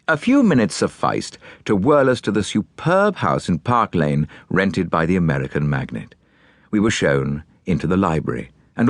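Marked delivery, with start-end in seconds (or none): none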